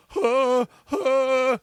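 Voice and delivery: high-pitched imitated sobs; mock sobbing. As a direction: wail in little hitching sobs, imitating her. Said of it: "like, sobbing".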